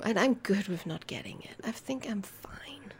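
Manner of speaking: soft unvoiced aside; whispered contentment. Real, not acted.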